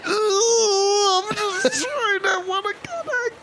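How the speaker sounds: high strained sickly whine. Acted. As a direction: whimper it out, very high. Fireball rep sick voice.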